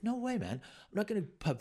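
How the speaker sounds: outraged no way; indignant refusal. Retold or imitated. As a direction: refuse flatly. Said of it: "I was like".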